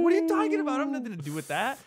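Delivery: high, loud, incredulous pushback; indignant protest. Retold or imitated. Refusing the coworker who demands a ride home. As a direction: protest that it has nothing to do with you.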